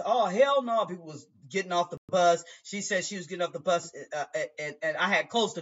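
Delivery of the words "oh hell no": higher, louder hell no; mock outraged passengers; fed-up passengers. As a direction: scoff like disgusted riders.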